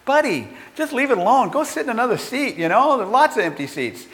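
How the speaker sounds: high-pitched, quick busybody interjection; annoyed passenger. Retold or imitated. Another passenger butts into the seat dispute, annoyed interjection. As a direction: imitate a fed-up bystander butting in.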